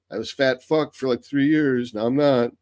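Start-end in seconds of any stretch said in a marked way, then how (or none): none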